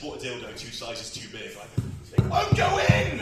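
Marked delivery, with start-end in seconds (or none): 2.2-3.2 high, loud exasperated yell; frustrated mock shout. Comic acting out a furious woman struggling, high frustrated shout.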